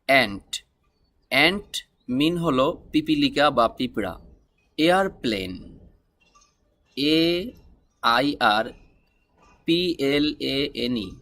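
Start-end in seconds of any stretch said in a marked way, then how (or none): none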